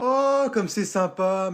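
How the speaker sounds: high, drawn-out sarcastic gushing; mock delight. Mocking a sibling's gift excuse, sarcastic. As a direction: gush slowly and high with irony.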